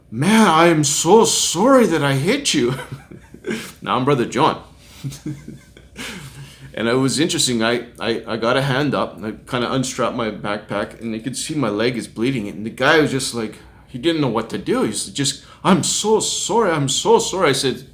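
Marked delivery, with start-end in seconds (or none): 0.1-2.7 slow, higher-pitched, emphatic mock apology; acted profuse apology. Acted driver's frantic apology. 15.7-17.5 higher-pitched, pleading mock apology; acted repeated apology.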